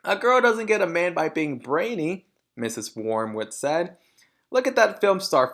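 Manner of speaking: high, loud preachy mother voice; snooty mother. A narrator's reading. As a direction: lecture her with vanity. Mrs Wormwood voiced.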